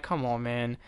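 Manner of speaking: hushed come on, man; quiet exasperation. Retold or imitated. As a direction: mutter it in disbelief. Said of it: "I'm like".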